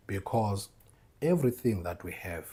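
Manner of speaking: normal talking voice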